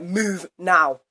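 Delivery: loud mock bossy command; mock teacher order. Mocking the teacher's order to move, loud and curt. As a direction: imitate the teacher barking an order. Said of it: "you're like".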